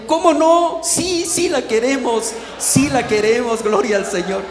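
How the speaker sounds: loud, high, jubilant yes; eager acceptance. Retold or imitated. Preacher accepting donated backpacks, eager acceptance.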